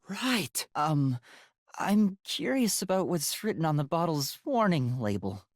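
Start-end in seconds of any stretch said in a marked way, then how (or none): none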